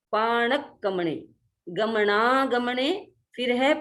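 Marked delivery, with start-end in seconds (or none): none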